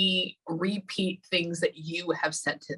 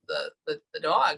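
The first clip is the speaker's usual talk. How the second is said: ordinary delivery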